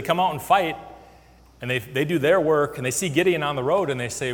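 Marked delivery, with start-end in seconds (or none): none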